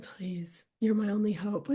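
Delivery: hushed, pleading whisper; mock whispered plea for help. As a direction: breathe it like a desperate secret. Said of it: "I literally said".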